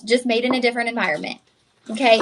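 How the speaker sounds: ordinary delivery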